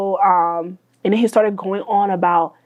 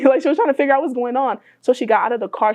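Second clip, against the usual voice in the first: usual speaking voice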